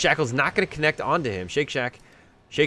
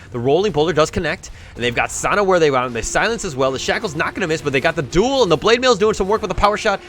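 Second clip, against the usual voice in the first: louder, higher, excited fight call; live play-by-play; 4 semitones higher, 7 dB louder than usual. Acted. Caster calling the teamfight to viewers, excited play-by-play. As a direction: ride the teamfight as it unfolds.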